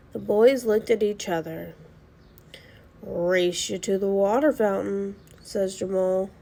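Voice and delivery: lively storybook read-aloud; reading to children. A narrator's reading. Teacher reading a storybook to kids, lively read-aloud. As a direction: read the story to young listeners.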